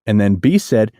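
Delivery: ordinary delivery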